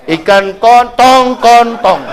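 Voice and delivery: slow "kontong" said in place of tongkol; imitating a kid botching "kontong"; mispronounced fish name. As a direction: drag out "kontong" like a child fumbling the word. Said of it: "Salah sebut".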